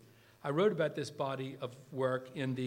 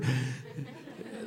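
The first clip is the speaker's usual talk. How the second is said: laugh; 3 dB quieter than usual. Real, not acted. Laughing at own Kubler joke.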